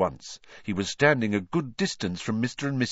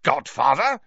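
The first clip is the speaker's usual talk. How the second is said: louder, rising outraged splutter; 5 semitones higher, 5 dB louder than usual. A narrator's reading. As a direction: choke the word out in disbelief. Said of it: "spluttered Uncle Vernon".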